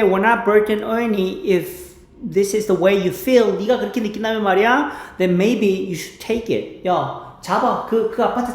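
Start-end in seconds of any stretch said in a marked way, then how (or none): 0.0-6.9 slow English phrases between Korean; slow drill. Shown as a demonstration. Teacher slowly practices the quarrel lines. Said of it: "slow repetition drill".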